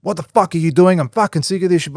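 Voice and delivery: an angry boss yelling at his worker, mock angry. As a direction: play the boss going off. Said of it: "and he goes".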